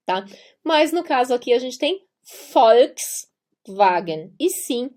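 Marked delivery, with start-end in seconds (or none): 2.5-4.3 very slow "Volkswagen" with an F sound at the start. Shown as a demonstration. Teacher showing German V as F, slowly. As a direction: stretch out "Volkswagen" as Folkswagen. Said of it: "tem o som de F".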